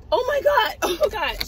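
high-pitched mock dismay; put-on squeaky-high fluster; torn other driver. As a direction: imitate her torn between sorry and admiring. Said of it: "she's torn between saying how cute".

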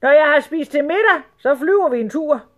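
high, loud booming announcement; imperious sultan. A narrator's reading. Sultan announcing a carpet trip, imperious. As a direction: proclaim it as a self-important sultan.

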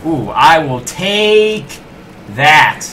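very slow, drawn-out words; loud, savoring drawl; gleeful claiming. Real, not acted. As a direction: stretch each word as you grab the prize. Grabs a power-up with relish.

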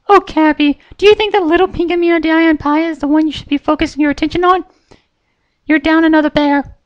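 very high pony voice, taunting; sweetly threatening; Pinkie Pie, menacing. A narrator's reading.

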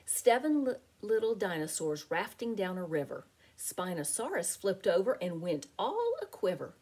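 usual speaking voice

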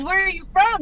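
put-on high-pitched Southern-accented question. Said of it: "he had a Southern accent".

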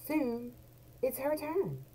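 ordinary delivery

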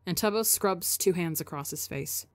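ordinary delivery

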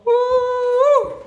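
high, loud woo; excited whoop. Real, not acted. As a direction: cheer it out. Cheering sign-off.

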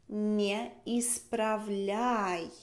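Не исправляй drawn out very slowly; very slow model. Shown as a demonstration. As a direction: stretch each syllable of исправляй for the learner. (muy despacio, sílaba por sílaba)

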